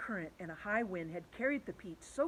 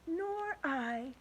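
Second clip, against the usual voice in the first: ladybug voice; 9 semitones higher than usual. Acted.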